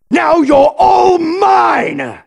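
high shout, very drawn out; villain moving in for the kill; triumphant threat. Acted. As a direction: gloat in a long, slow yell. Villain closing in on enemy.

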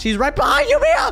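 high frantic yell; panicked warning. Real, not acted. Monster chasing her teammate.

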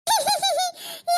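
high, nervous little laugh; high staged titter; sheepish giggle. Acted. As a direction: giggle to cover being caught out. Sheepish laugh after being scolded.

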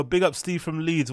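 ordinary delivery

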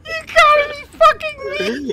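very high, loud shout; disbelieving shout. Real, not acted. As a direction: yell in sheer disbelief. A throne just broke.

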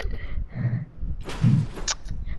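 quiet, voiceless wheezing laugh; breathy laugh. Real, not acted. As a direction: laugh softly on air alone. Laughs after whoa outburst.

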